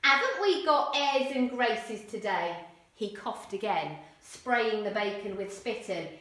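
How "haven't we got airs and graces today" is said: louder, slow Cockney sneer; mock him in loud Cockney; Cockney mockery. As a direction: Eric sneering at the boy, Cockney. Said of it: "really strong Cockney accents".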